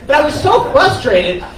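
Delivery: normal talking voice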